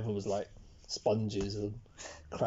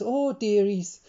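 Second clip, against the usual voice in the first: high, sing-song imitation of a Scottish teacher; Mrs Doubtfire Scottish voice; 8 semitones higher, 4 dB louder, much slower than usual. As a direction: do the old Scottish teacher fussing over the kids, in a Scottish accent. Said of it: "She sounded like Missus Doubtfire".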